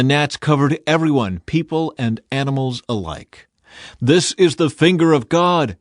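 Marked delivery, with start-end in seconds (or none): 4.0-5.8 higher and louder, an awed outcry; exclaimed. A narrator's reading. Magicians exclaiming to Pharaoh, higher and louder. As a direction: blurt it out as if struck by the sight. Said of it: "the magicians exclaimed to Pharaoh".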